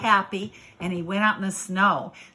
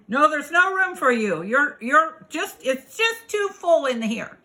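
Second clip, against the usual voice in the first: higher, firm animal voice; refusing animals; 6 semitones higher, 4 dB louder than usual. Acted. Animals telling the little bug no, higher and firm. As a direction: turn the newcomer away, pitched up.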